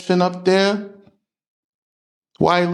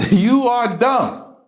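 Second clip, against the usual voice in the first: scolding; 8 semitones higher, slower than usual. Real, not acted.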